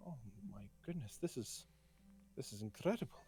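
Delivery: soft, awed gasp of wonder; hushed awe. Acted. Shopkeeper marvels softly at the sundial. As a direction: breathe it out in quiet awe. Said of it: "And she goes".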